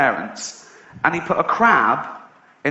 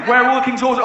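officer's demand; 8 semitones higher, 3 dB louder, faster than usual. Acted. Acted officer questioning.

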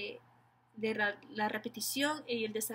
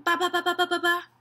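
fast, loud babble at a raised pitch; rapid repetition; 6 semitones higher, 7 dB louder, faster than usual. Shown as a demonstration. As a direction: rattle it off like a playful sound game. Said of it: "repetir algo rápido". (repetición rápida, aguda y fuerte)

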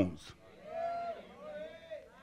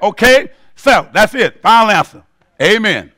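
normal talking voice

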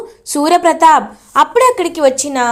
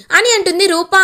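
ordinary delivery, nothing marked

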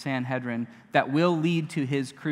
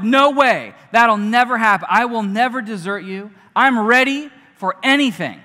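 eager protest; 5 semitones higher, 9 dB louder than usual. Retold or imitated. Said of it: "He's like".

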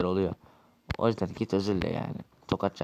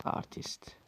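whispered jab; 12 dB quieter than usual. Acted. Muttered insult aside.

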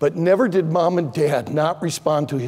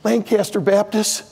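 usual speaking voice

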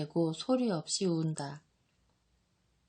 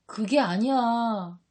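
louder, drawn-out protest; exclaiming; 3 semitones higher, 5 dB louder, slower than usual. A narrator's reading. Thomas objects to the prophecy, exclaiming. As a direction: cry out in slow objection. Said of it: "하고 토마가 외친다".

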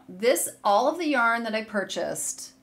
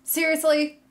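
higher, louder, thrilled seriously; excited disbelief; 8 semitones higher, 6 dB louder than usual. Real, not acted. Knitter gushing over yarn to viewers, thrilled exclaim.

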